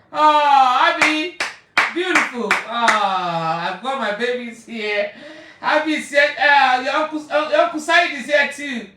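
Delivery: high, loud, overjoyed welcome; loud joyful greeting. Real, not acted. Welcoming her relatives into the live stream with loud, high joy. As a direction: welcome them in with a happy shout.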